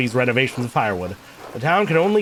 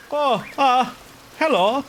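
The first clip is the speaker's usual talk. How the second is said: put-on higher-pitched German-accented character; 11 semitones higher, slower than usual. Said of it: "went for the German accent with this guy".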